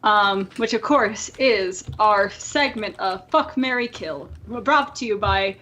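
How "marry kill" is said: ordinary delivery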